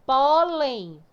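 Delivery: "Pólen" with a loud, drawn-out first syllable; stress on first syllable. Shown as a demonstration. Teacher showing pupils the strong syllable, first syllable of "Pólen" stressed. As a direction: stretch and punch the PÓ in "Pólen". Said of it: "a sílaba mais forte vai ser a que tem acento".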